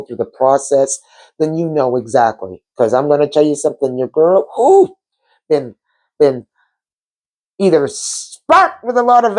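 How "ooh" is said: sudden high ooh. Real, not acted. Telling viewers how her week went, a high ooh. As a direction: squeal a quick high ooh.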